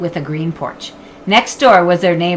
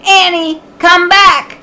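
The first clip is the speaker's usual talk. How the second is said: calling out; 12 semitones higher, 8 dB louder, slower than usual. Acted. Jack calling after her.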